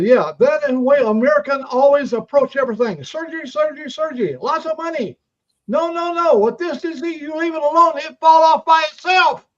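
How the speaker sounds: high, loud mock Chinese accent. Chinese doctor lecturing the patient about American surgery, in a high mock Chinese accent.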